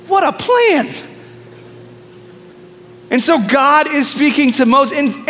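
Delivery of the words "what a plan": sarcastic. Real, not acted. Sarcastic aside.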